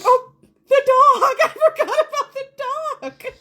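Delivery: high, loud, laughing realization; laughing panic. Retold or imitated.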